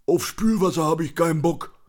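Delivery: high, loud character voice of a griping drinker; put-on cantankerous boozer; grumpy catchphrase. A narrator's reading. Conny's grumpy catchphrase in narration.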